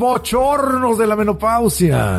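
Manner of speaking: voz aguda, alargando cada palabra; proclámalo lento y exagerado, en broma; anuncio teatral. Pretend. Remate teatral sobre los bochornos. (pregón burlón y arrastrado)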